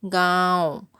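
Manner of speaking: गाँव said slowly with the vowel through nose and mouth; teacher models the nasal vowel in गाँव; nasalized vowel demo. Shown as a demonstration. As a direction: let गाँव ring nasally, slow and clear. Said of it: "इसका उच्चारण नाक और मुंह दोनों से होता है".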